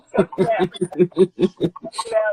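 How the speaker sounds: stretched-out giggling come sit down; laughing. Retold or imitated. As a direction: laugh your way through inviting her to sit. Son invites mom, laughing.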